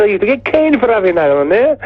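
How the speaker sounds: very high Welsh caricature; Welsh accent. Retold or imitated. Welsh fan recalls school punishment to the band, Welsh accent. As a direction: complain in a high-pitched mock Welsh voice. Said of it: "when we play in Wales".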